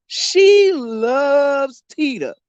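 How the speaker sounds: LOVES stretched out slowly; drawn-out emphasis. Real, not acted. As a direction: linger long on "She loves Tita". Emphatic repeat about sister's fandom.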